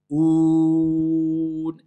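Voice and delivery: long clean Oun; clear OU. Shown as a demonstration. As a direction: hold a pure OU in Oun before closing on N. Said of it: "ça doit être un ou clair".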